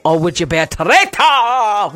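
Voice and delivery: put-on theatrical Scottish accent, long rolled word; imitated dramatic Scottish flourish; Alan Cummins's Scottish accent. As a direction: stretch it out in hammed-up Scottish. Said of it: "the way he says it".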